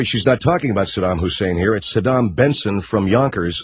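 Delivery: normal talking voice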